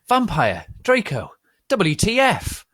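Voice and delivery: high, loud incredulous cry; exasperated demand. Acted. Narrator confronting two fighting boys, high and loud. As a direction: demand an answer from both. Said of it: "I asked".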